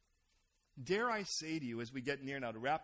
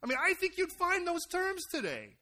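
loud preaching peak; 13 semitones higher, 9 dB louder than usual. Real, not acted.

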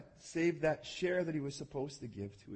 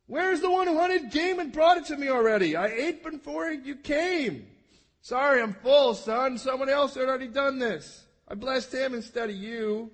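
put-on high, loud shaky old father; trembling Isaac; 7 semitones higher, 8 dB louder than usual. Isaac telling Esau he already blessed another, high and quavering. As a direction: quaver out the bad news. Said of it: "He trembled exceedingly and he said".

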